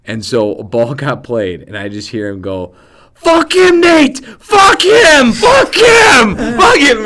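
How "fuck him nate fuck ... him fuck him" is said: put-on high, full-throated yell in a thick German accent; imitated German coach yelling. German coach to his player mid-drill, yelling. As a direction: holler it across a field like a German coach. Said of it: "he's just yelling across the field".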